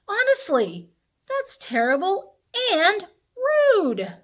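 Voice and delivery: mock scolding. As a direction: scold the burping dragon for the kids. Reader mock-scolds the dragon.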